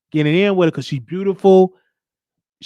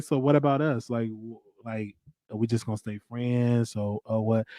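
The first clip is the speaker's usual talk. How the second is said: low, soft, stoned come-on; deepened voice; 7 semitones lower, 7 dB quieter than usual. Retold or imitated. Asking his friend about us, deepened voice. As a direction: imitate your voice sinking as the weed hits. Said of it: "why your voice getting deeper".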